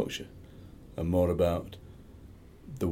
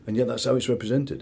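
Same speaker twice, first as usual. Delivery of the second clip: ordinary talk, nothing marked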